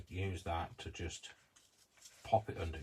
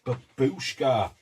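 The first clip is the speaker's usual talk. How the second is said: loud, drawn-out cry of eureka; triumphant recall; 5 semitones higher, 12 dB louder, much slower than usual. Real, not acted. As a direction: shout it slowly as the word finally comes back. Suddenly recalls the forgotten name.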